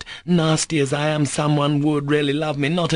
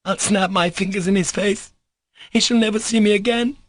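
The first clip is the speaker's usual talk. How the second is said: hysterical scream; 7 semitones higher than usual. A narrator's reading. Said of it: "in an hysterical scream".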